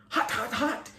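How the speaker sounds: high, quick startled yelps; boy startled by a fireball in his palm; yelping exclamation. A narrator's reading. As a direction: yelp at the sudden fire in hand. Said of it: "he exclaimed".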